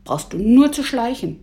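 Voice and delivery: put-on mildly agitated boy's comeback; worked up. A narrator's reading. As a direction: snap back, a touch heated. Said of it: "Emil regte sich auf".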